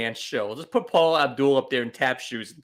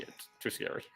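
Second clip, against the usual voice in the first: scared whisper; 17 dB quieter than usual. Real, not acted. Spider talk frightens him.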